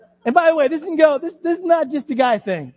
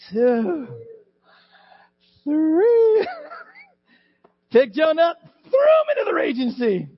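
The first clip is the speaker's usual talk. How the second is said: excited shout; 7 semitones higher than usual. Acted. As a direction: hurl the last count like the big throw. Counting the throw, storytelling climax.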